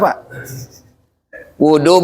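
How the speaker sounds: ordinary delivery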